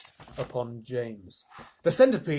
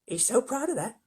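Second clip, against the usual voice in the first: much higher ladybird; 10 semitones higher than usual. Acted. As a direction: tease lightly, high. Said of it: "said the ladybird".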